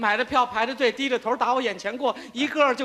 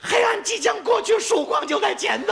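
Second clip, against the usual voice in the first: mock heroic; 7 semitones higher than usual. Heroic pledge to his wife.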